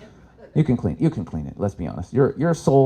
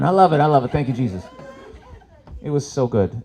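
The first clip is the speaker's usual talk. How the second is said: ordinary talk, nothing marked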